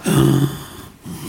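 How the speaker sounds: a quick in-drawn gasp of awe; sharp gasp. Retold or imitated. Sisters seeing tiny carving, amazed gasp. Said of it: "everybody took this deep breath".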